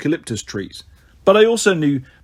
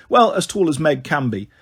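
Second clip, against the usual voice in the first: plain reading voice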